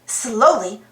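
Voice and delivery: ordinary delivery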